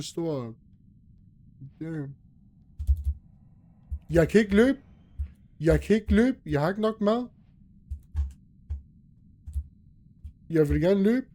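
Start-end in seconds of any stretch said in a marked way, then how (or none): 4.1-11.3 higher, louder panicked cries; panicking as the monster nears. Real, not acted. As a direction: yell it in rising panic. Panicking in horror game.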